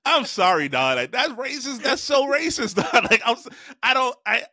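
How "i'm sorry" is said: normal talking voice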